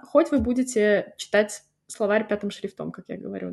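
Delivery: ordinary talk, nothing marked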